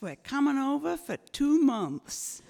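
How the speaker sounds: imitated American in-laws, pitched high; American accent. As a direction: announce it in a put-on American accent. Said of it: "it's the in-laws from America".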